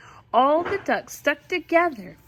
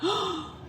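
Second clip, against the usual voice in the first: mock gasp; 10 semitones higher, 5 dB quieter than usual. Shocked gasp at story.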